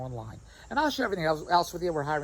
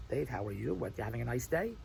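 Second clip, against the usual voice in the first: lower, softer dull greeting; bland small talk; 6 semitones lower, 6 dB quieter than usual. Retold or imitated. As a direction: mimic boring polite chat. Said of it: "don't say".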